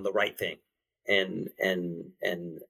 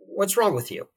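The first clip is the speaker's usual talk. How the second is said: critical inner voice, higher pitched; critical self-talk; 9 semitones higher than usual. Retold or imitated. Man voicing his inner monologue about a situation, critical. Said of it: "I'm incredibly critical".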